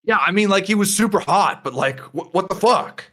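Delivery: high, incredulous objection; indignant protest. Acted. Tass to a companion gushing over the man, indignant protest. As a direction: protest loudly in disbelief.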